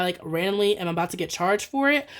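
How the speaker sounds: usual speaking voice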